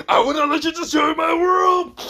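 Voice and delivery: defiant heroic battle shout; loud, very high-pitched cry of defiance; Goku. Acted. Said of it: "pretty much Goku says".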